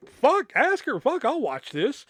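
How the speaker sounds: eager. Retold or imitated. Eager retold reply.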